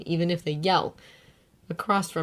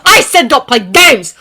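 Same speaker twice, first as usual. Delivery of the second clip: angry; 7 semitones higher, 15 dB louder than usual. A narrator's reading. Bakugo angry.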